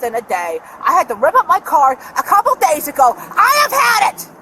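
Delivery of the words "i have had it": higher, slow and fed up, hammering each word; real angry yell. Real, not acted. Woman yelling at the officer over her stolen card, angry slow yell. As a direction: let it burst out slowly, at the end of her rope. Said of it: "you don't need to yell at me".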